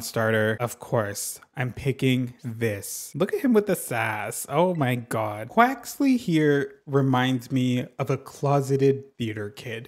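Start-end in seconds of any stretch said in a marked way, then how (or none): none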